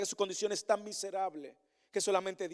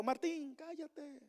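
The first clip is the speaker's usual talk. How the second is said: hushing; 7 semitones higher, 10 dB quieter, much slower than usual. Pretend. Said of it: "mandarme a callar".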